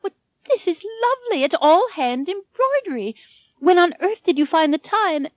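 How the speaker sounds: put-on high, fast, delighted old woman; oohing old lady. A narrator's reading. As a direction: gush over the gift. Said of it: "oohed and awed".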